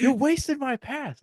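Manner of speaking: very high imitated complaint; whine-yell it at a teammate; high-pitched mock outrage. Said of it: "yelling at me".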